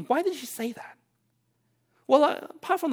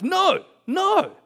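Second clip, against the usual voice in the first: whining protest; 11 semitones higher, 5 dB louder than usual. Retold or imitated. Child refusing to leave park.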